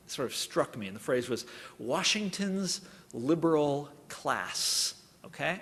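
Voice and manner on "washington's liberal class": slow sneer leaning on liberal; sneering robocall. Retold or imitated. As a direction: sneer out liberal slowly, word by word. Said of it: "sneering emphasis on the second word".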